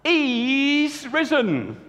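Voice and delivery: high, very slow announcement; joyful news. Acted. Mary announces to the two men he is risen. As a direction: draw out each word in wonder. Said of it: "she said".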